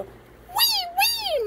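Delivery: squeaky high comforting; high toy voice. Pretend.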